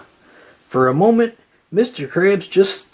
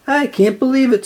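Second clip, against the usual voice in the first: high, eager exclamation; excited cry; 8 semitones higher, faster than usual. A narrator's reading. SpongeBob overjoyed at signing, crying out. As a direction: burst out in delight. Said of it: "SpongeBob cried".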